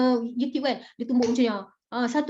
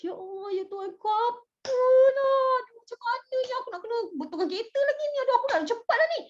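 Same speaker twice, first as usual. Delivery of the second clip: regretful whining; 9 semitones higher than usual. Acted. Teacher acts a driver fretting over repairs and delay, regretful high whine. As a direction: moan and fret, pitched well up. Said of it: "intonasi kau sangat menyesal".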